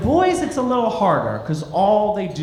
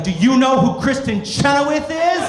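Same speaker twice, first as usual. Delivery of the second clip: sassy shouted comeback; 6 semitones higher than usual. Retold or imitated. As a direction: fire back triumphantly.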